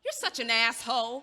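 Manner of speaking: loud, spat-out insult; angry outburst. Acted. Woman insulting the nosy neighbor, loud and angry. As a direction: hurl the insult at him.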